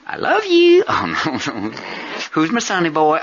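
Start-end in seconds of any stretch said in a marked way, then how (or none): 0.1-0.8 high, loud mom voice; high mother voice. Retold or imitated. Imitating his mother. 2.3-3.2 mother voice. Retold or imitated. Imitating his mother.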